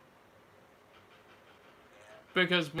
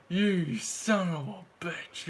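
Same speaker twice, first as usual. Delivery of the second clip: playful mock insult; 4 dB louder, slower than usual. Real, not acted. Playful shock at friend hopping on.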